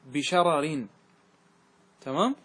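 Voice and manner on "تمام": usual speaking voice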